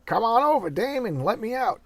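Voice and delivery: put-on high calling voice to the girl outside; mock calling out.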